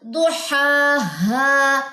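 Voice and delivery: very slow, drawn-out हा हा, throaty and clear; recitation demo. Shown as a demonstration. Teacher showing how हा हा is read, very slow. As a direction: stretch हा हा the way it is recited. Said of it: "तो ये कैसे पढ़ा जाएगा?".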